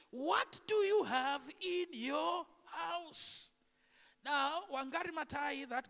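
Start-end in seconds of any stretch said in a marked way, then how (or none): 0.1-3.5 slow, higher, loud prophet's question; booming Elisha. Acted. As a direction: demand it from the pulpit.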